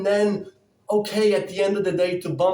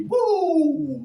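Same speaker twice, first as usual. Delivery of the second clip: high whoop of fasted energy; excited whoop; 11 semitones higher, 3 dB louder than usual. Real, not acted. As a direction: let out a high, excited cheer.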